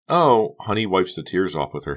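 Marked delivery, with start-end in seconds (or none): 0.1-0.5 loud high oh; realizing oh. Acted. Honey suddenly understands, loud high oh. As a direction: dawn of realization.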